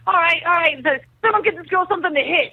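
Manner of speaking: high, fast, excited director; director voiced. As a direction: rush it out like the eager director. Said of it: "He's like".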